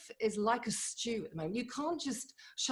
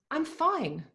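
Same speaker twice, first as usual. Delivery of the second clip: forced cheer; 7 semitones higher than usual. Retold or imitated. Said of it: "we all know what that means".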